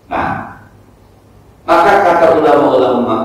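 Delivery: normal talking voice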